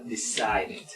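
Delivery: "decided" with its last syllable sounded; "decided" said carefully for learners; extra-syllable ending. Shown as a demonstration. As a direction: model "decided" with an added "id" ending. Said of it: "toda palabra que termine en D".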